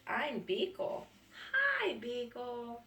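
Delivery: I'm Beagle and Hi, Beagle said slowly; slow read dialogue. Acted. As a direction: spell out the dialogue gently for a child. Reading dialogue slowly to child.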